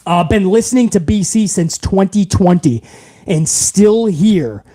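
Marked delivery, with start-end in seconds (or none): none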